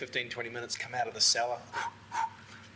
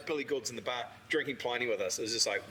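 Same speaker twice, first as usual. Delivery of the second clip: ordinary talk, nothing marked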